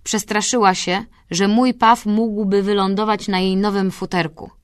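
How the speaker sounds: ordinary delivery, nothing marked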